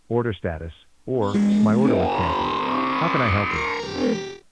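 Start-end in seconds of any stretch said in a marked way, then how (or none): none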